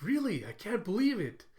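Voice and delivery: high-pitched acted disbelief; surprised. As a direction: exclaim as if amazed by the taste. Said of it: "they're both just surprised".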